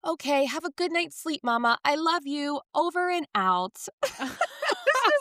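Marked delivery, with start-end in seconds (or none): 0.1-3.9 put-on high, sweet little-boy voice; imitating Leo. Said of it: "And Leo goes". 3.9-4.8 real laugh. Real, not acted. Laughing at story.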